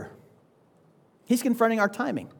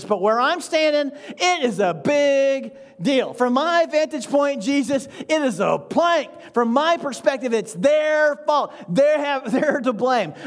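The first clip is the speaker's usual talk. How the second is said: indignant protest; 4 semitones higher than usual. Acted. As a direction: argue your case hard, stretching it out slowly. Arguing with Jesus in role.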